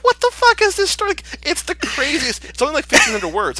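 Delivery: high, loud, incredulous squeal; baffled outburst. Retold or imitated. Reacting to a baffling fan story, squealing in disbelief. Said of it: "I'm like".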